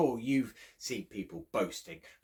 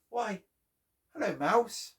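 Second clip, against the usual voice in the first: higher, friendly hello; greeting mouse; 10 semitones higher, 3 dB louder than usual. Acted. Storyteller greeting Mouse for the children, higher.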